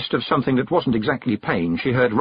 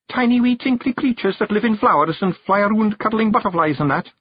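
high little Scottish Feegle voice; Awfully Wee Billy, explaining; 11 semitones higher than usual. Acted. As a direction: explain eagerly as a tiny Scot. Narrator plays Wee Billy.

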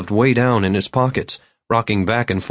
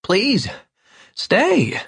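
put-on pleading drunk Vern; 13 semitones higher than usual. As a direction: plead in a high wail. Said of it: "eyes filming over".

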